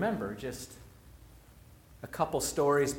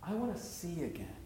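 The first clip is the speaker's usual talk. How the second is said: soft longing; 8 dB quieter than usual. Shown as a demonstration. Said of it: "the piece that gets me".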